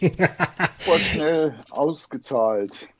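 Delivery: slow, drawn-out ad slogan; casino ad voice. Retold or imitated. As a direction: read it like a cheesy TV ad. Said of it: "seit Hyperino".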